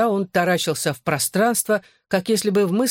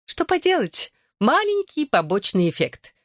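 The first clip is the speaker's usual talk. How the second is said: sly and playful, pitch jumping up high; sly, with a wink; 9 semitones higher than usual. A narrator's reading. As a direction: tease it with a knowing wink. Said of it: "лукаво подмигнула Лили".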